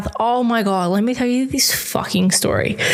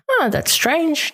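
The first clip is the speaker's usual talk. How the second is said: surprised; 7 semitones higher, slower than usual. Retold or imitated. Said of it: "we go".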